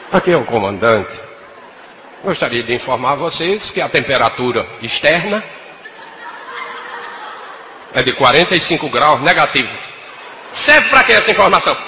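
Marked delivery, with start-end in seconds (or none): 0.1-9.7 cabin speaker drone, deep and mumbled; pilot cockpit headset. Retold or imitated. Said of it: "comandante de novo". (voz de comandante no alto-falante)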